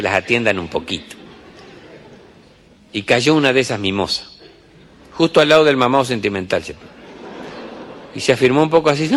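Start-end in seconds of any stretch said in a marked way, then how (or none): none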